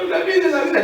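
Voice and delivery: high, hammed-up sigh over life; mock lament. Preacher mocking a drinker's lament, hammed-up whine. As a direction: whine it like a self-pitying drinker.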